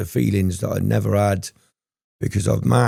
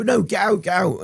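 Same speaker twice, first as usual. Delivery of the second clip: high mock barmaid ordering him out; indignant barmaid; 11 semitones higher than usual. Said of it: "You go".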